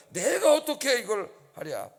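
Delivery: mock doubt. Moses' doubting words voiced.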